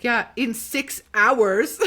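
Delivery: imitating the guard's slow, emphatic retort; pointed guard reply. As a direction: stretch each word as a hammed-up complaint.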